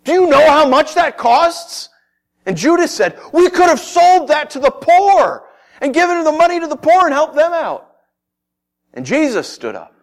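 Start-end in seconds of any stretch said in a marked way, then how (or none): 0.1-1.9 loud, high acted outrage at the waste; indignant objection. Disciple objects to wasted perfume. 3.3-8.0 high, loud acted complaint; Judas's indignant protest. Judas objects to wasted perfume.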